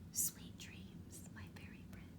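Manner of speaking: faint breathy goodnight whisper. Acted. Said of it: "she whispered".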